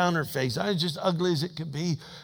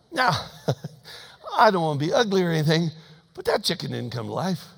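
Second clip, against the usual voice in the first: ordinary delivery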